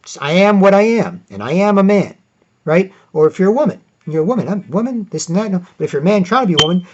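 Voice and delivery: ordinary talk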